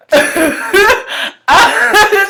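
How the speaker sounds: high, loud cackle; real laughing fit. Real, not acted. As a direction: crack up at your own joke. Cracking up at own remark.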